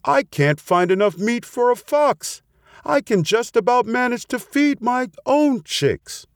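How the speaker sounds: high-pitched distressed protest; plaintive storybook bird, no real sobbing; mock tearful. A narrator's reading. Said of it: "as she burst into tears".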